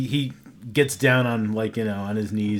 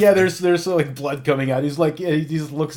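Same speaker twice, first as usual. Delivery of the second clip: usual speaking voice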